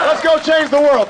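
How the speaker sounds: rousing send-off. Real, not acted. Candidate closing the rally, rousing call. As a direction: send the crowd off fired up.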